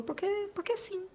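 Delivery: high, soft little-boy voice, imitating her son; lightly voiced small son answering; a young son shrugging off the question; child's voice. Son's reply voiced in retelling.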